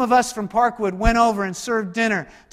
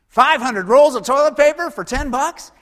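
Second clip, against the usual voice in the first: higher, louder gush over a bargain; mock thrilled shopper; 6 semitones higher, 7 dB louder than usual. Shopper to spouse at Costco, thrilled and louder.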